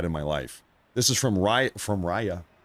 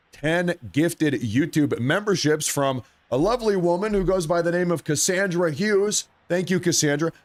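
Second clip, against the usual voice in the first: higher-pitched, excited shout-out; 7 semitones higher than usual. Real, not acted. Streamer thanking Cassandra for gifted YouTube memberships, excited. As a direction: announce the gift with rising excitement. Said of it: "I can't get too excited".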